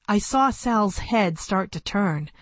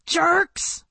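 put-on high yell of a boy; small kid yelling at the bullies; 9 semitones higher than usual. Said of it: "He yelled".

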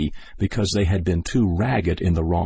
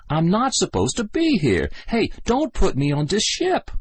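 higher, mock plaintive protest; 12 semitones higher than usual. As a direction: whine that it's a mistake. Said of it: "Sligo's voice came plaintively".

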